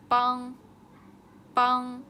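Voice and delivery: loud classroom model of 帮; teacher modelling word. Shown as a demonstration. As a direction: model 帮 loudly and clearly for students to copy. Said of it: "vocabulary drill, teacher models word".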